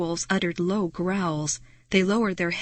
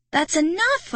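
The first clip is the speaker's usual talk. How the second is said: higher, louder angry shriek; 9 semitones higher, 4 dB louder than usual. Acted. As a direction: shriek at the werewolves. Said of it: "I shrieked".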